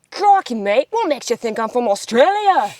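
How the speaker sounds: put-on Australian accent. Answering a comment asking for an Australian accent, hammed-up. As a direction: exaggerate a passionate Aussie exclamation. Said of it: "Australian accent".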